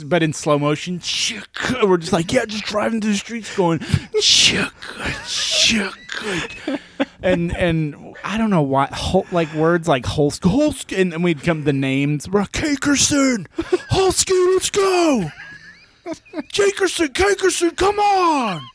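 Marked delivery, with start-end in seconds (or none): 4.2-5.8 unvoiced gun-cock noise; whispered gun cock. Gun sound effect whispered. 12.5-18.6 high calling-out voice. Retold or imitated. Yelling made-up names.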